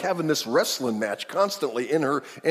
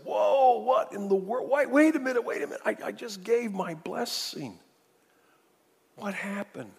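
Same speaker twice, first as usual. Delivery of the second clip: acted stunned disbelief; 9 semitones higher than usual. Isaac learning he blessed the wrong son, stunned disbelief. Isaac realises he was tricked.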